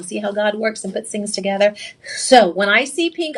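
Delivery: usual speaking voice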